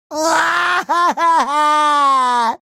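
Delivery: long cartoon bawling cry; wailing sob. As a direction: burst into a drawn-out wail. Lost best friend.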